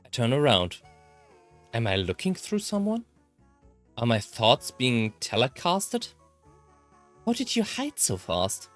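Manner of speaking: normal talking voice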